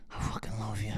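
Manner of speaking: hushed whispered declaration; mock tender whisper to his wife; whispered love. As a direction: whisper it tenderly after the rage. Sudden whisper after rage.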